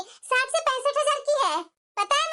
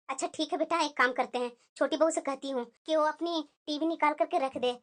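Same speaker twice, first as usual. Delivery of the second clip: put-on lower, softer voice of an older mother; 10 semitones lower, 6 dB quieter than usual. Mother agrees to take the daughter-in-law's TV, in a lower, softer voice. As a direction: settle the matter like an older mother talking to her daughter.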